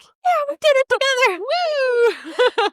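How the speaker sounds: high mock cheer; hammed-up high friend-zone cheer. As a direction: cheer it high like a goofy sidekick. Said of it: "The friend zone boy is like".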